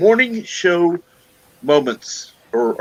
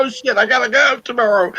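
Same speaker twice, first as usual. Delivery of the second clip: mock panic; 11 semitones higher than usual. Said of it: "Then it'll be like".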